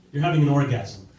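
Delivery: usual speaking voice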